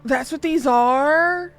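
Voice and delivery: high, slow, astonished question; stunned, drawn-out disbelief; surprised. Real, not acted.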